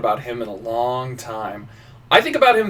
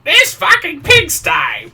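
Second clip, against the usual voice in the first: much higher, slow, disdainful queen voice; haughty queen; 7 dB louder, much slower than usual. Retold or imitated. Queen sneering at a messy room, high and slow. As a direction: sneer it as a high posh royal. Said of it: "should she be like".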